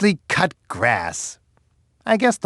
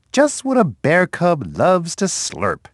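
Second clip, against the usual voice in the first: ordinary talk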